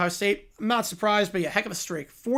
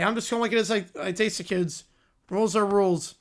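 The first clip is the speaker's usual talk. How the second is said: ordinary delivery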